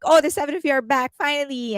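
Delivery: imitated happy cheer. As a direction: play a thrilled reporter cheering, lightly. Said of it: "The reporter was so happy".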